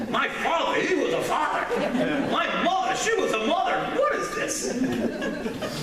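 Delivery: high, hammed-up exclaiming; incredulous mock outburst. Acted disbelief at being a parent.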